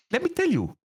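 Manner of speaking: high-pitched Greek-accented ranting, imitating Forgiven; Greek accent impression. Player mimicking Forgiven complaining about his support, high Greek accent. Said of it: "you're doing it".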